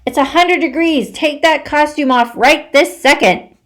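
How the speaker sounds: mother shouts across the house; mom yelling. Acted. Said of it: "Then my mom yells".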